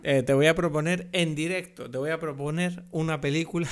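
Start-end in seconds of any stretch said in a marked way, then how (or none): none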